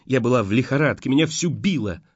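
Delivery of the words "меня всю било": ordinary delivery, nothing marked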